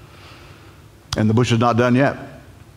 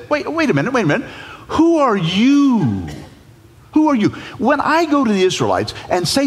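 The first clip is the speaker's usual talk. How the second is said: much higher, protesting reluctant Moses; panicky Moses; 11 semitones higher than usual. Acted. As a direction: squeal your objections to the bush. Moses voiced demanding.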